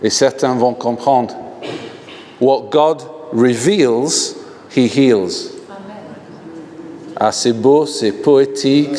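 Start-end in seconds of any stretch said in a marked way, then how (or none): 2.4-5.5 What God reveals said slowly, each word weighted; slow English motto. Shown as a demonstration. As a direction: deliver the English phrase slowly and solemnly. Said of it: "une phrase en anglais".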